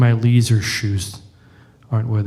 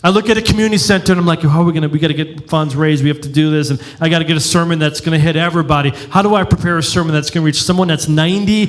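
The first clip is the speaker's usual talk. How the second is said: frantic pastor; 5 semitones higher than usual. Retold or imitated. Rants as stressed pastor.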